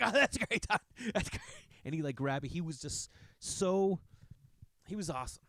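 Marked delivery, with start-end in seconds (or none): 0.0-1.4 high, loud burst of delight; much higher, louder mock praise; excited old neighbor. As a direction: gush like a thrilled old man. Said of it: "he would get so excited when you tell him stuff".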